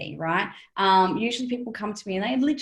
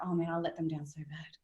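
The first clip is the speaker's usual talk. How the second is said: breathy whisper, much softer; whispered regret; 3 semitones lower, 9 dB quieter than usual. Retold or imitated. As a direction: let it slip out as a guilty whisper. Rueful self-reproach, looking back.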